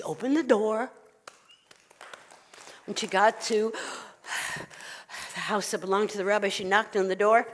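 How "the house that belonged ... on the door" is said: ordinary delivery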